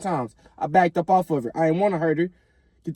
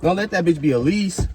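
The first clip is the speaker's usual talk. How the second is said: ordinary talk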